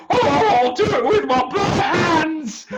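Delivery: high, loud, crying-out hero; hammed-up battle shout. Perseus charging the sea monster, loud high yell. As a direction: charge in bravely and foolishly.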